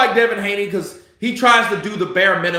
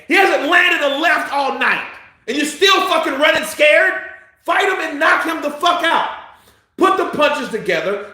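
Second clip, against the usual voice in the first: yelling at the fighter; 9 semitones higher than usual. Real, not acted. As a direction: bark it at the TV like a fan. Shouting at the fighter.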